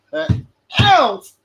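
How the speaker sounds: high, loud outburst; sudden shout. Acted. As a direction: belt it out suddenly. Bursts out with a shout.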